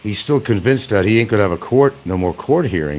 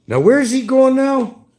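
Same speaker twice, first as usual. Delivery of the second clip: high put-on voice; 5 dB louder, slower than usual.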